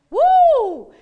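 high, loud joyful whoop; sudden whoop of praise; excited whoop. Real, not acted. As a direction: let out a happy holler.

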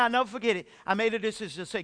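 normal talking voice

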